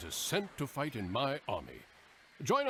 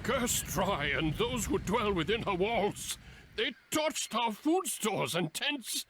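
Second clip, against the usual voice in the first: raging, slow and higher; furious curse; 9 semitones higher, slower than usual. Acted. Commander rages at the burnt camp, furious and slow. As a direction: spit the curse out slowly in fury.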